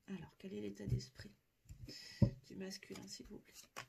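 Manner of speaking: hushed whisper to the guides. Real, not acted. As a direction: breathe the question, barely voiced. Asks the guides privately.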